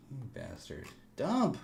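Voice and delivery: muttered curse into high yell; calm turning angry. Real, not acted. Cursing then yelling at chart.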